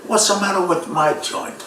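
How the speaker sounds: a hint of a complaining proprietor; club owner. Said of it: "the guy's always in the corner".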